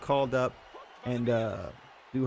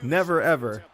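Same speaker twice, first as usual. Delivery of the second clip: louder, teasing "never, ever" through a smile; grinning never ever; 3 semitones higher, 6 dB louder than usual. Retold or imitated. Retelling CM Punk's interview reply, louder, grinning. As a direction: toss it off with a playful grin. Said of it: "with a big smile on his face".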